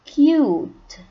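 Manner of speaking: cute said loud and high with a long U like kyoot. Shown as a demonstration. Teacher models cute loudly for the class. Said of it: "So the word here is".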